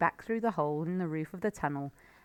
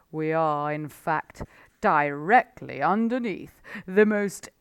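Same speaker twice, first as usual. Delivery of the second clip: loud, knowing, relishing; the fox grinning slyly at Badger; sly Mister Fox; 7 dB louder than usual. A narrator's reading.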